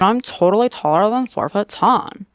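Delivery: put-on pouty, drawn-out Californian accent; slow mock California-boy drawl through a pout; Stephen Miller impression. Mocking a politician as a sketch-show Californian, pouty California accent. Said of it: "place that pout on one of the characters from S N L's The Californians".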